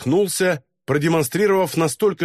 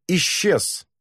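plain reading voice

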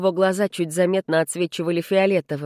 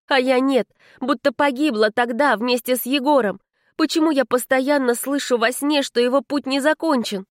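higher-pitched, urgent inner monologue; girl stuck in time, questioning herself; troubled young heroine; 7 semitones higher than usual. Acted. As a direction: voice her anxious thoughts in a raised pitch.

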